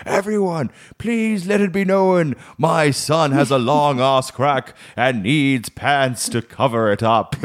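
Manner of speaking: high, slow proclamation as mum; drawn-out, hammed-up town-crier announcement; mock public announcement. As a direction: imitate mum informing the whole store. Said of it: "because she'd speak quite loudly".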